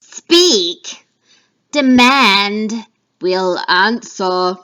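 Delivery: loud, eerie choral witch voice; three witches together. Acted. Witches answering Macbeth together, loud and eerie. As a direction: speak as one coven. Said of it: "all three witches talking together".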